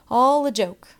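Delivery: loud, high jeer. A narrator's reading. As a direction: sneer it out loudly. Boy jeers again.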